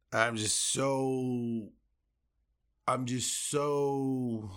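very slow, soft, trailing-off despair; defeated. Real, not acted. Fan host drained after the loss, slow and soft. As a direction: let the words sink out of you.

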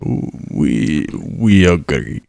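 deep, loud, slow guttural voice; low, terse Bulgarian accent. Acted. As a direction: growl it like a scary, stout Bulgarian captain. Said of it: "Bulgarian language is very guttural".

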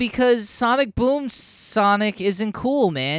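high-pitched, drawn-out exasperation; real fed-up fan outrage; indignant rant. Real, not acted. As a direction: complain in pained, rising disbelief. Ranting about disliked character redesigns.

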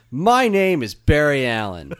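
a grave opening voiceover, fighting a laugh; imitating Flash intro. Mocking the Flash's opening line to his cohosts, solemn but laughing. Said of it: "spot on on their intonation".

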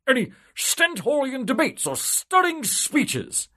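high, loud, indignant bureaucrat; pompous official. Acted. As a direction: puff up like a smug politician. Civil servant asks eagerly.

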